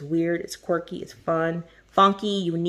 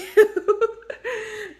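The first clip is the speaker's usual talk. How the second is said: high giggle; 3 dB louder than usual. Real, not acted. Laughing between thanks.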